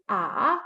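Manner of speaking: A hooked down and back up; dipping third tone. Shown as a demonstration. Teacher likening it to a hook, A dipping then rising. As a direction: dip the voice on A, then lift it. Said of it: "Like a hook in your".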